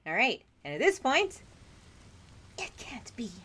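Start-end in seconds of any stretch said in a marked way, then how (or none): 2.6-3.3 whispered disbelief, breathless. Acted. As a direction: whisper it to yourself in shock. Wife alone, disbelieving.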